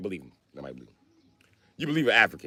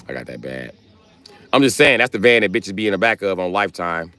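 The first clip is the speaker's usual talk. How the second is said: ordinary talk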